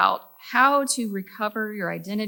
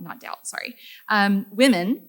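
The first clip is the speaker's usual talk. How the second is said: normal talking voice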